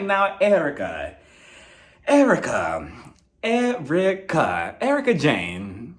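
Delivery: slow, drawn-out name-calling; chiding, with long deliberate pauses; mock reproach. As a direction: stretch the name out in mock disapproval. Mock scolding Erica for hypocrisy.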